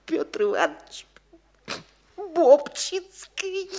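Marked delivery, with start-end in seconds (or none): 2.1-3.7 Бобчинский stretched very slowly, higher and louder; drawn-out name. Acted. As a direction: linger proudly on Бобчинский, much higher. Bobchinsky proudly stresses his name. (Бобчинский протяжно, выше и громче)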